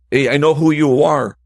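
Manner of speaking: retold threat. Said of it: "threatening me from the back".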